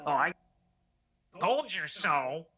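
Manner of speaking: drawn-out taunting "told you so"; smug slow singsong gloat; mocking told-you-so. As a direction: gloat it slowly, stretching the words. Mocking her own told-you-so.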